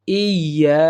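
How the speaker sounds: "ইয়া" with leaned-on "iyy" and long "yaa"; correct tajweed. Shown as a demonstration. Teacher modelling the correct stretch, careful tajweed. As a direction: hold both parts of "ইয়া". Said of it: "সঠিক উচ্চারণ হচ্ছে".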